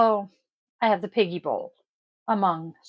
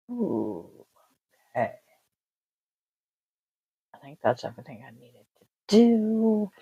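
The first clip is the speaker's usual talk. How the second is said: ordinary talk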